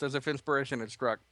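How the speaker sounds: usual speaking voice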